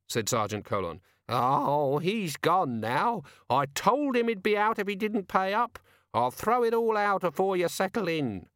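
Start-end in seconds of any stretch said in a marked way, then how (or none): 1.3-8.5 put-on high, old landlady voice. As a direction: voice a gossipy landlady.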